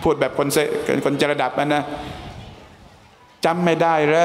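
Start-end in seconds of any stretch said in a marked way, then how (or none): 2.6-4.3 drawn-out, pleading question; slow plea. Acted. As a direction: stretch the words out, hurt that he forgets. Companion asks if he forgets. (ถามช้าๆ อย่างน้อยใจ)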